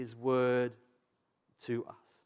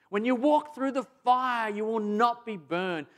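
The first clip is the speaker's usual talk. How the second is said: higher, louder proclamation; preaching at full force; preaching build; 9 semitones higher, 7 dB louder than usual. Acted. Preaching Isaiah climax.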